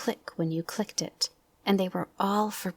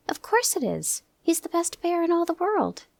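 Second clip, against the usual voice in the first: Christopher Robin's voice; 12 semitones higher than usual. Acted.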